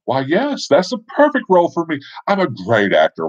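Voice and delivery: high, eager self-important delivery; flattered ham actor. Acted. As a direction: puff up proudly at being cast as the hero. Flattered character accepts hero role.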